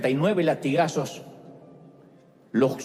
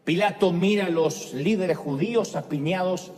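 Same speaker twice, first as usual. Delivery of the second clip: ordinary delivery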